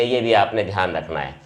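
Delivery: ordinary talk, nothing marked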